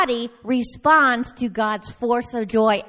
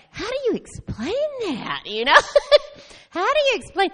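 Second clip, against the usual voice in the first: pitched up high in mock helplessness; high amused exasperation; 10 semitones higher, faster than usual. Wondering how to explain her joy to the officer, high amused disbelief. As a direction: squeal the question, half laughing.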